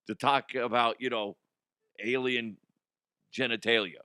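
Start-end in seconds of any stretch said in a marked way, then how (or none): none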